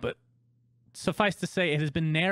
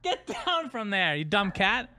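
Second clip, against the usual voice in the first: slow, high scolding; scolding the cat; 7 semitones higher, slower than usual. Real, not acted. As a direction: tell the cat off slowly.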